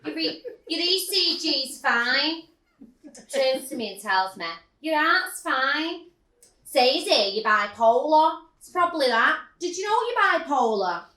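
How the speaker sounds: imitating the nurse reading her notes; mock nurse Sandra. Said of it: "She then said to me".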